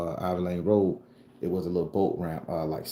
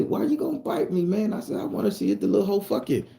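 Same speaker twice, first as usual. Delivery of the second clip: whiny, much higher complaint; high-pitched protest; 13 semitones higher than usual. Retold or imitated. Boy pleading with a snake to pass, high protest.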